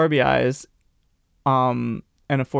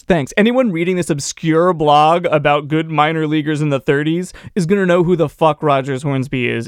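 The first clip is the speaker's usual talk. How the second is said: ordinary talk, nothing marked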